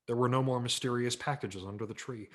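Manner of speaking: usual speaking voice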